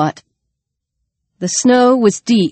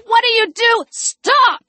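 high, distressed outcry; alarmed protest; 14 semitones higher than usual. A narrator's reading. Alex confronts Jess over the attack, high alarmed cry.

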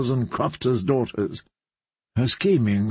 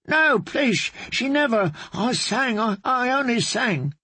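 high, frightened pleading bard; terrified bard pleading; 10 semitones higher than usual. Acted. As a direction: plead for his life, voice up high.

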